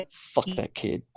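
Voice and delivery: under-the-breath whispered curse. Real, not acted. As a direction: whisper it as an aside. Aside about the winning player.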